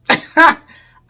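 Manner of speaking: shrieking burst of laughter; high loud laugh. Real, not acted. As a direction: crack up at yourself. Laughs after refusing.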